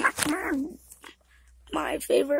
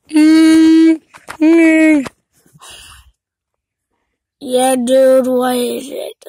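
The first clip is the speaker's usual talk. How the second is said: loud phone answer; 3 semitones lower, 15 dB louder, much slower than usual. Pretend. Pretend phone call.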